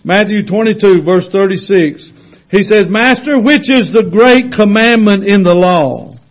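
loud, high, slow preaching; full-force preaching. Acted. Preacher to his church, preaching at full force. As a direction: drive the scripture home to the congregation.

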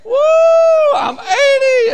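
high, loud, crying shout; old lady shouting her age in church; old lady's shout. Retold or imitated. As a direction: holler like a joyful old woman. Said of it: "And she says".

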